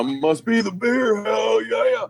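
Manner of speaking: hearty cheer. Real, not acted. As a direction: cheer it like raising a beer. Cheering for beer.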